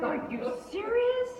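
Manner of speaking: high-pitched disbelief; incredulous question. Retold or imitated. Mother told to ride again, incredulous. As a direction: question it high, hardly believing it.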